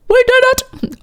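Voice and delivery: high-pitched celebrating squeal; excited squeal. Real, not acted. Reader done with the long poem, excited high squeal. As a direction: cheer it way up high.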